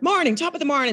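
high, sing-out mock Irish greeting; hammed-up Irish accent. Housemate heckling her every morning, mock Irish accent. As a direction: chirp it in a put-on Irish accent. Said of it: "stop doing the Irish accent".

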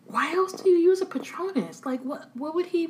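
high, incredulous questioning; as if the answer is obvious; exasperated. Real, not acted.

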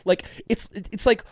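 put-on high, loud, plummy rich-elite accent; mock a smug upper-class accent; a wealthy World Economic Forum elite, hammed-up accent; posh elite accent. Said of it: "here he goes with another accent".

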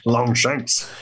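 put-on slow, rowdy drunken challenge; drunk king voice. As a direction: draw it out like a tipsy brawler. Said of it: "Have at you, Witcher".

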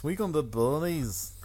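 hammed-up Scottish accent. Showing off his many accents, dubious Scottish accent. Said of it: "I'm a man of many accents".

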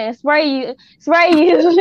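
building panic, ending in a yelp; rising mock panic. Mimes spraying Lysol.

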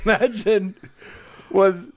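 ordinary delivery

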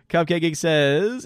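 ordinary talk, nothing marked

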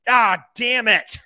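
loud, high, drawn-out mock curse; retold frustrated outburst. Upset fan answering after his team is scored on, imitated exasperated yell. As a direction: imitate a fan cursing a goal. Stranger's reaction to conceded goal.

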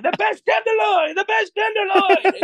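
put-on shouted high in a thick Greek accent; very high, loud Greek-accented sales cry. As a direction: bellow it like a proud Greek restaurant wife, in a Greek accent. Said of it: "this thick Greek accent saying".

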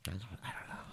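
barely audible hush; whispering. Real, not acted. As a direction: whisper it back. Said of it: "I kinda like whispering though".

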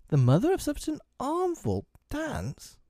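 very high-pitched exclamation; crying out. A narrator's reading. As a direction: cry out in high-pitched protest. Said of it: "cried Wendy".

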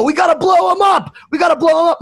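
high, loud, hyped-up chanting; excited party shout. Retold or imitated. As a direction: pump up the crowd, shouting high.

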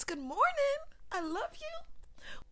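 high sweet sing-song greeting to a plant. Pretend. Speaker greets her houseplants in the morning, high cooing voice. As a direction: coo it like talking to a baby. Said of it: "greet your plants".

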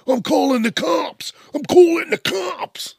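high, loud crying shout of a petty neighbor; panicky neighbor. Retold or imitated. Neighbor whining over grass clippings, crying shout. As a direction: wail it like a hysterical neighbor calling the police.

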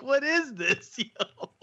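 high-pitched incredulous question through laughter; laughing disbelief. Retold or imitated. Host retells seeing the strange cartoon, high laughing disbelief. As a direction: replay being baffled while cracking up.

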